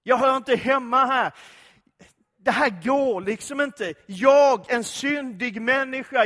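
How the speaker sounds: loud anguished cry of unworthiness; despairing outcry. Acted. Isaiah crying out before God's throne, loud and anguished. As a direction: cry out that you don't belong here. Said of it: "den första upptäckt han gör".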